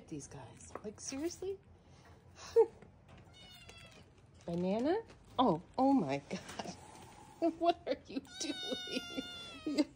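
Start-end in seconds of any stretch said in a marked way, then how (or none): 2.6-10.0 high squealing laugh. Real, not acted. Laughing at the kitten climbing the fence, squealing high. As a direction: laugh through the words in disbelief.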